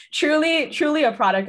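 laughing burst, high and loud; cracking up on the word. Real, not acted. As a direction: laugh spilling into speech. Laughing at nineties fax scene.

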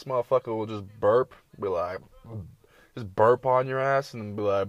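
ordinary talk

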